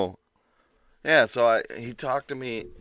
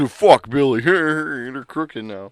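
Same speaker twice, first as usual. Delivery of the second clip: louder, slow, spiteful jab; spiteful quote; 4 semitones higher, 6 dB louder, much slower than usual. Retold or imitated. Coworker stacking crooked on purpose, spiteful quote. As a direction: say it out of pure spite.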